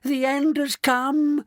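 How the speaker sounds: slow, high wailing scream of doom. A narrator's reading. Terrified grandma wailing that the end has come. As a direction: drag it out like the world is ending. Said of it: "screamed Grandma Georgina".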